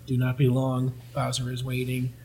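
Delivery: monotone robotic delivery; even, mechanical monotone. Retold or imitated. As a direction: imitate a robot giving a warning. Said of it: "for being robots, they're really doing".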